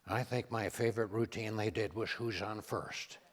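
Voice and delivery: imitating Reagan, low and soft; a mild Reagan impression, unhurried and folksy; Ronald Reagan. Teller imitates Reagan chatting with a foreign president, low and soft. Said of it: "Reagan says".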